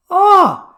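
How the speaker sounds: sudden high loud yelp of surprise; startled yelp. Retold or imitated. Grabbed by the belly, he lets out a high yelp. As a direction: squeal like your belly just got grabbed.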